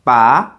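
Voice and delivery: rising "ba"; "ba" with a quick climb in pitch; second tone. Shown as a demonstration. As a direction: say "ba" sliding upward like a question. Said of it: "For the second tone".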